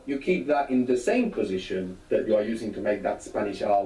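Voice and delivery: ordinary talk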